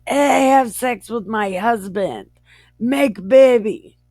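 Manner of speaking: loud, high mock command; hammed-up boss lady; acted bossy employer. Acted employer ordering her servant.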